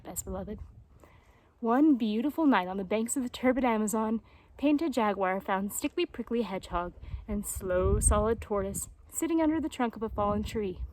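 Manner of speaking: usual speaking voice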